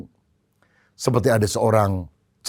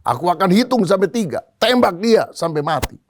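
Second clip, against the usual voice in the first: general ordering his guards, pitch raised; acted angry command; 5 semitones higher than usual. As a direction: give forceful orders in mock fury. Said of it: "kepada pengawalnya". (perintah jenderal yang marah)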